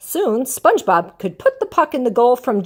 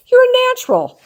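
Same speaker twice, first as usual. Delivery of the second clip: much higher, louder salesman pitch; agent voice; 7 dB louder than usual. Acted. Sports agent flattering SpongeBob, high loud pitch. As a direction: sell it hard.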